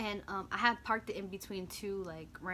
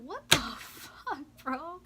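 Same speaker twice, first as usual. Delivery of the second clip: high, drawn-out disbelief; retold exasperation; 7 semitones higher, 5 dB louder, much slower than usual. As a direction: complain in stretched-out, loud disbelief. Retold exasperation at popped tire.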